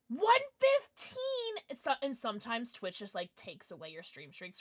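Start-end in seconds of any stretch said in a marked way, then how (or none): 0.1-1.6 excited high callout. Real, not acted. Excited high slow shout.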